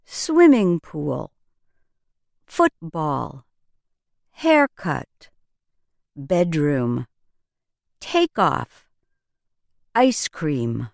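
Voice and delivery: each compound read slowly with the first part "Swimming", "foot", "hair", "bed", "take", "ice" hit hardest; stress on first syllable. Shown as a demonstration. Teacher modeling for English learners, first-syllable stress. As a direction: land on the front word of each pair, as in "ice cream". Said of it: "Listen to more examples that stress the first syllable".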